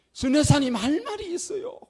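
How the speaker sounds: high, loud woman's voice; older deaconess interrupting the pastor, high and loud; deaconess calling out. Retold or imitated. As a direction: call out eagerly like an older churchwoman. Deaconess raising hand to speak.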